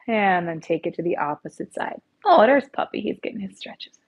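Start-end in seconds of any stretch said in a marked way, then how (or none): 2.3-3.0 delighted surprise. Real, not acted. Dog walks into the class. 3.0-4.0 whispered aside. Real, not acted. Aside about the dog.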